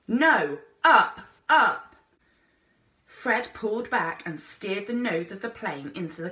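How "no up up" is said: high, loud call; loud command upward; urging shout. A narrator's reading. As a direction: push him on with a shout. Explorer urging plane upward.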